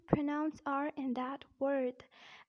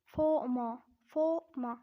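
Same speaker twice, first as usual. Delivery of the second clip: emphatic British "former", 'faw-muh'; British accent; 4 dB louder, slower than usual. Shown as a demonstration. Teacher showing learners the British "former", British accent. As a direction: drop both Rs in "former", British style, louder. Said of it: "in a British accent, it is said as".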